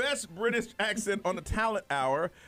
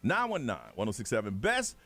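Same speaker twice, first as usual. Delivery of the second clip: radio promo read; 6 semitones lower than usual. Acted.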